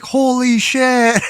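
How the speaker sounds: loud, high and drawn out; shocked exclamation. Retold or imitated. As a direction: blurt it in disbelief, stretched. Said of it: "everybody's like".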